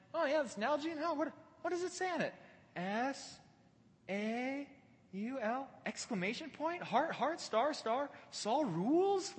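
high-pitched mock puzzlement; sarcastic. [name] reads out the stolen bottle's label, sarcastic. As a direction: pretend to puzzle out a label, teasing. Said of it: "the sarcasm and the joke in this".

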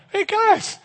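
very high, drawn-out falsetto call; mock show-off calling to his mates in a squeaky high voice; hammed-up Simon Peter. Peter showing off to the men in the boat, in a high, comic falsetto. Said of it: "and he's like".

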